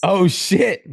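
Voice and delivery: high, loud impressed outburst; excited surprise. Real, not acted. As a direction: react like big news just landed. Reacts to client's ten K news.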